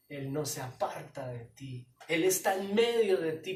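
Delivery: usual speaking voice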